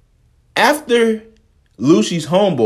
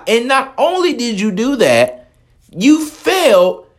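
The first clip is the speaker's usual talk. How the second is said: ordinary talk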